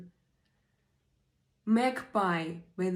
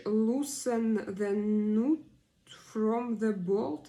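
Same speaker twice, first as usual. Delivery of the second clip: slow dictation; 3 dB louder, much slower than usual. Shown as a demonstration. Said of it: "slowly dictating the definition".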